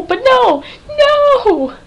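high, wailed no; dismayed protest. Real, not acted. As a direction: climb into a dramatic "no". Protesting what happens on the show.

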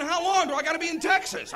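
high, loud comic exasperation; exasperated outburst. Acted. Stranded traveler thinking to himself, loud exasperated plea. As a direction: blurt it out in despair at your company.

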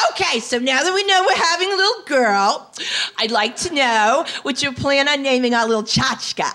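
a hint of a pushy, thrilled mother-in-law. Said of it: "She was very excited".

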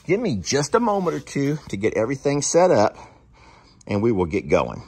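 ordinary delivery

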